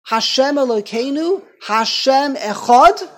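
community declaring its faith before death, loud and slow; martyrs' proclamation. Retold or imitated. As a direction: proclaim Shema with everything. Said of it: "the whole community said".

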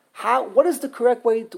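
usual speaking voice

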